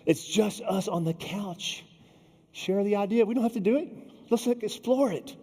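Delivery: a hint of a mother coaxing her shy son.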